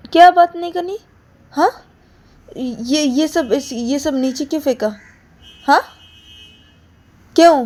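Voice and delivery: high, pressing questions; indignant questioning. Acted. Ananya presses Payal about the thrown bags, indignant. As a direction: push her for an answer.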